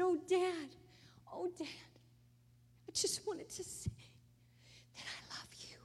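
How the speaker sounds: high, breaking voice fading to a whisper; tearful goodbye. Retold or imitated. Daughter telling her dying dad she loves him, crying and whispering. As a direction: sob out the goodbye.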